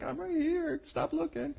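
mock exasperated reply. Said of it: "He's like".

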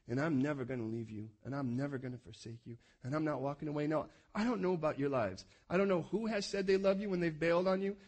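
ordinary delivery

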